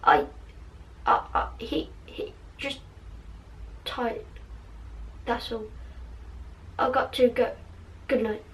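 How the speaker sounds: hushed, halting stammer; barely voiced, with long hesitant gaps; stuttered. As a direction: stutter softly in broken little pieces.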